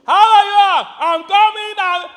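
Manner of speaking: high, loud household yell; imitated yelling. Preacher imitating a family that always yells, loud and high. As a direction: bellow a friendly greeting across the house. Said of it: "Everybody yells".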